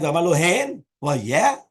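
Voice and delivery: "hen" and "yeah" rise as loud questions; rhetorical yes. Shown as a demonstration. Teacher reading a text's yes as no, rhetorical. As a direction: lift "hen" and "yeah" into doubt. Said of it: "depends on how you say the yes and the no".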